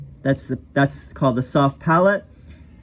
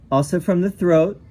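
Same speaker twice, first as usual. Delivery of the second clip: ordinary talk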